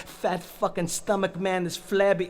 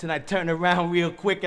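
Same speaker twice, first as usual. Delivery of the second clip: ordinary delivery, nothing marked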